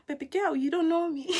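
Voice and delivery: high, sassy laughing retort; sassy comeback. Retold or imitated. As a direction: throw it back with sass. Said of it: "I'm like".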